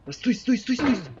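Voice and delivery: high panicked shouting; yelling stop. Real, not acted. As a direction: shout as you slide away. Sliding in game, yelling stop.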